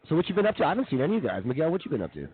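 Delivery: ordinary talk, nothing marked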